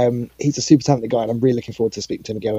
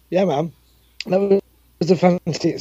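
hoarse, fading voice; a strained, worn-out voice; losing voice; 7 semitones higher than usual. Real, not acted. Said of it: "Sorry, I'm losing my voice now".

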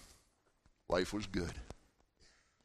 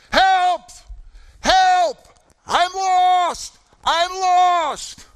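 put-on high, full-force cry of a lost child; screaming for help; 14 dB louder, much slower than usual. Lost young camper screams to the counselors, blood-curdling screams. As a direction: yell desperately, drawing out each cry. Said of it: "we started hearing the screaming".

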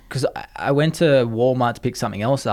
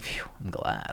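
soft unvoiced relieved sigh-words; relieved whisper; 9 semitones lower, 15 dB quieter than usual. Speaker recalling future relief, whispering.

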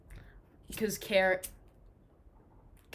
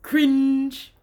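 loud, high mock heckle; imitating a friend's loud put-down; mocking jeer; 7 semitones higher, 11 dB louder than usual. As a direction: call it out to shame a friend. Imagined friend mocking him.

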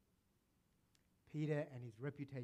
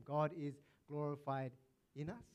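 ordinary talk, nothing marked

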